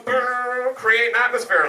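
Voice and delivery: high-pitched motor noise into mumbling echo; mock crazy local. Jimmy heading off to create atmosphere, hammed-up. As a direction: imitate a local character trundling off.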